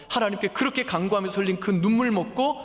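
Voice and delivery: 높고 크게 외치듯 설교; 목소리를 높여 절박하게 호소하기; impassioned preaching. Acted. Preaching at full force. (열정적으로 외치는 설교)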